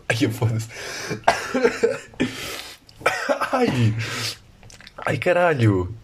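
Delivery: real coughing fit after swallowing water; coughing, gasping out the words; choking on a drink. Real, not acted. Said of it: "Engasguei-me com água".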